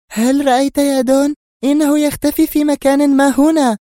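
high, loud woman's voice, triumphant; excited Sonia. A narrator's reading. Sonia tells the Don the intruder hides here, excited. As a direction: exclaim that she was right. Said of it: "هتفت سونيا في انفعال".

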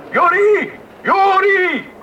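high, loud call across a platform; calling the boy from the train door. Acted. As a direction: shout his name to reach him.

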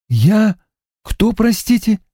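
ordinary talk

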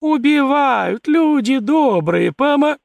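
slow, high, loud wail for help; wailing lament. A narrator's reading. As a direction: cry out like a village woman. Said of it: "охнула и запричитала".

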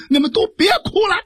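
high, fast shout to stop the weeping; shouted order. Acted. Jiang Ping yells at the weeping brothers, high fast shout. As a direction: bark it out high and quick to silence the room. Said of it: "大喊了一声".

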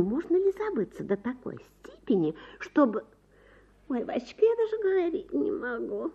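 wife scandalised, telling her husband the gossip. A narrator's reading. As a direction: gasp over the scandal, half enjoying it.

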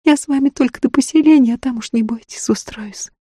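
high voice fading to a whisper; eager peasant woman. A narrator's reading. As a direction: reassure them in a high, keen voice. Woman reassuring the travellers, keen.

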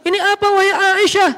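high, declaiming sermon delivery; khutbah tone. Shown as a demonstration. Demonstrating the wrong way to question Aisyah, khutbah tone. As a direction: pitch it up like a preacher addressing a congregation. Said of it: "Kalau nada khutbah".

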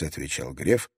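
ordinary delivery

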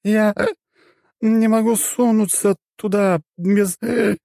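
high, slow, slurred hesitation; drunk goddess voice. Acted. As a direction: fumble for the word, tipsy.

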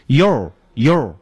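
"yo" with the tongue curled back, r-coloured; retroflex mispronunciation. Shown as a demonstration. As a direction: curl the tongue on "yo", like "yor". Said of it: "把它发成卷舌的".

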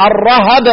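الرهب as ar-rahab; "ra-hab" in two syllables for الرهب; fatha on both letters. Shown as a demonstration. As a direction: say الرهب with short a on ر and on ه. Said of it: "يبقى عندي ايه؟".

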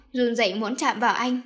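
plain reading voice

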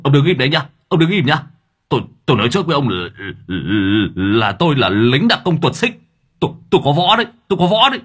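panicky threat. A narrator's reading. Driver Hà bluffing about martial arts, panicked. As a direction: bluff bravely while terrified.

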